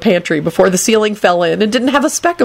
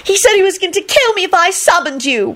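high, plaintive wail; distressed whine, pitched way up; 12 semitones higher than usual. A narrator's reading. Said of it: "Rosie wailed".

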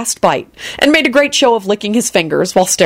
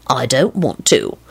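low, slow stubborn refusal; deep-pitched childish obstinacy; childishly solemn; 5 semitones lower, 3 dB quieter, slower than usual. A narrator's reading. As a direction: refuse slowly, solemn as a toddler. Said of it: "as solemnly as a three-year-old".

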